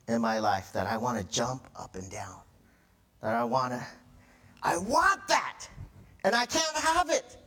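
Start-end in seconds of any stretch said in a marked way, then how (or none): 4.6-7.4 put-on high-pitched toddler-style tantrum outburst; mock tantrum. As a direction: act out a whining, demanding fit, pitch climbing. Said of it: "throw a tantrum up at the pulpit".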